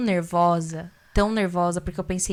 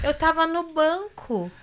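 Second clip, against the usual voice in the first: slow, higher-pitched unbothered reply; mock nonchalance; 8 semitones higher, slower than usual. Husband answering his angry wife, imitated as if nothing happened. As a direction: imitate someone casually oblivious, drawn out. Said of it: "como se nada tivesse acontecido".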